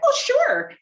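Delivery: very high, chirpy imitation; oblivious cheer. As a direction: chirp it, blissfully unaware. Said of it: "totally oblivious".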